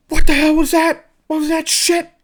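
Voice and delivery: imitated rage, shrieked; mock furious shout. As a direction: yell it like a hothead who heard someone laugh. Chris retold, turning on laugher.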